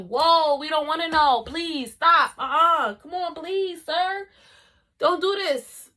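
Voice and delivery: high, loud, whiny begging; pleading. Retold or imitated. Shocked classmates begging him to stop, pleading. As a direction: beg them to stop, dramatized.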